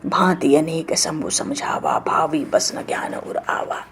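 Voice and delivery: slow whisper of the couplet; a slow whispered recitation of scripture; whispered verse. Acted. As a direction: breathe the verse out slowly, almost silent.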